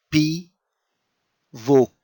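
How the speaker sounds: "P" then "vok", split apart; teacher spelling out the word; syllable by syllable. Shown as a demonstration. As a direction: sound out "P, vok" slowly. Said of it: "And again here".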